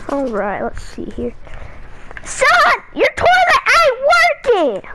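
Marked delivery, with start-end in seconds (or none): 2.2-4.9 high, slow immigrant dad voice. Acted. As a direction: play the clueless dad, high and drawn out. Son voicing dad with accent.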